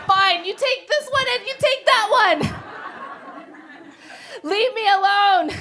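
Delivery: frazzled mom surrendering; exasperated give-up. Retold or imitated. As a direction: give in with a loud huff.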